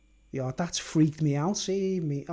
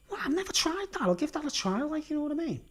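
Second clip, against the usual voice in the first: high inner voice; 8 semitones higher, 4 dB quieter than usual. Retold or imitated. Retelling own thoughts in high voice.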